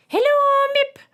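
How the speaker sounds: very high, loud piercing voices; piercing alien chorus. A narrator's reading. Sweaty aliens greeting the robot, piercing high squeal. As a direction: squeal it like tiny giraffes. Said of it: "they replied in unison".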